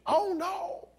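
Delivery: very high, hammed-up squeal of alarm; mock horror. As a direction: squeal it as if the worst just happened. Mock alarm at Bible translation.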